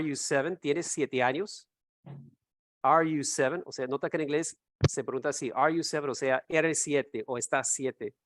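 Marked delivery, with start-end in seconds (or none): none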